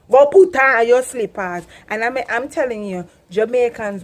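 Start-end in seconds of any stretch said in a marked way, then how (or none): 0.1-1.6 Jamaican parent command. Shown as a demonstration. Jamaican mother ordering her child, loud Patois. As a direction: bark it like a Jamaican mum. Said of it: "we full of expression".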